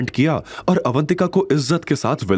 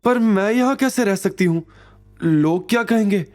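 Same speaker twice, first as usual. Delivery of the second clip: sad voice; 9 semitones higher than usual. A narrator's reading. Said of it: "उदासी भरी आवाज में कहा".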